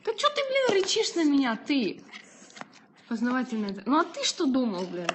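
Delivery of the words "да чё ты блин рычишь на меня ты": scolding. Real, not acted. Scolding the growling dog.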